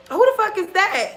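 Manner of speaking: high-pitched retold challenge; indignant demand. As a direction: demand an answer, pitched well up. Retold demand to the unknown woman.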